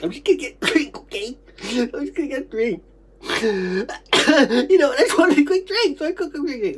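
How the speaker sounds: high voice breaking into coughs; upset pleading recollection. Retold or imitated. Recalling wanting a quick drink, high pleading voice. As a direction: plead upward, explaining yourself.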